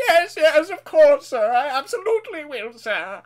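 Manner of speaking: high, loud-starting eager assent; eager grovelling. Acted. Newcomer to the Fat Controller, eager obedience.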